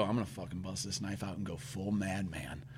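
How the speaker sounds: hushed, low retold thinking; inner thought. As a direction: voice a private resolve under your breath. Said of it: "And in my head".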